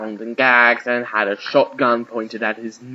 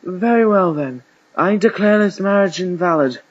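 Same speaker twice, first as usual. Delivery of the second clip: loud, raised official proclamation; archbishop declaring; 7 semitones higher, 5 dB louder than usual. A narrator's reading. Archbishop freeing the groom, loud and declamatory. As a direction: pronounce it grandly, pitched up.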